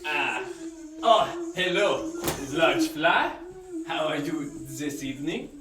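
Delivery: hammed-up fake French accent; a chef in a cheap French accent talking to a fly; bad French accent. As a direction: lay the cheesy French accent on thick. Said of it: "you really want a bad French accent".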